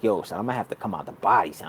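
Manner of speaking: low, quiet and fast, like a voice inside his head; inner thought voice. Retold or imitated. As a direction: mutter the urge to himself, low and quick.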